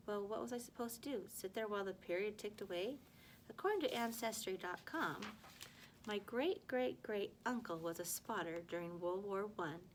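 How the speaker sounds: ordinary delivery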